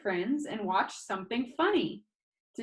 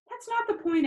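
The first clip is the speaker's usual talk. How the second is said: insistent; 14 semitones higher, 4 dB louder than usual. Acted. Insisting in argument.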